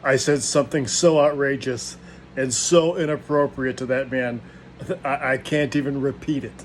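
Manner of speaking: usual speaking voice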